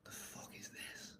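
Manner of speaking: slow, soft disgusted whisper. Retold or imitated. Reading his old poems, whispered disgust. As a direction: hiss it under your breath.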